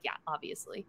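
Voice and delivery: near-silent breathy admission; mock sheepish whisper. Friend retelling a heroine's shy admission, hushed whisper. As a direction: breathe it out as a grudging confession.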